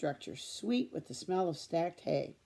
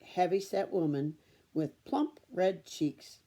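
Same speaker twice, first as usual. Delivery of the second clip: usual speaking voice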